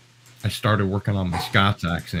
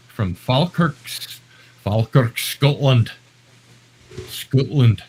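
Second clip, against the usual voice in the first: higher, louder mock Scottish accent; horrible Scottish accent; 5 semitones higher, 5 dB louder, slower than usual. Host greets a viewer from Falkirk, bad Scottish accent. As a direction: put on a hammed-up Scots accent. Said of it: "I do a really horrible accent".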